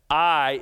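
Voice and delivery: I hit hard and loud on its own; emphatic I. Shown as a demonstration. Preacher isolates "I" for his congregation, stressed loud. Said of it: "is emphatic".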